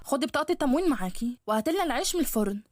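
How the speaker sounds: a sharp, needling stepmother taunting her stepdaughter; nagging stepmother. Acted.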